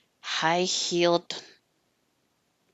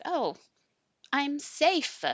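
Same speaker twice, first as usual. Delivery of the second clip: relieved realization; 9 semitones higher, slower than usual. Retold or imitated. Relief after panic.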